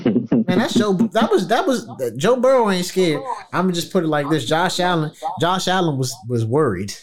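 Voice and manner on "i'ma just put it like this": normal talking voice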